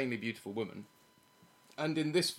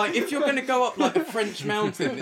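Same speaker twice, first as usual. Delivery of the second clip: laughing; 10 semitones higher, 9 dB louder than usual. Real, not acted. Laughing while ranting about bike.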